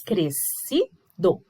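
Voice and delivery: "Crescido" bem devagar, CRES, CI, DO; separação de sílabas. Shown as a demonstration. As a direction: dite "Crescido" com pausa em cada sílaba. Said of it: "a separação fica". ("Crescido" silabado e mais grave)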